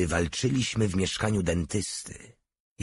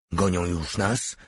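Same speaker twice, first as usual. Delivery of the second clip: usual speaking voice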